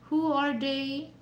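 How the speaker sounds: ordinary delivery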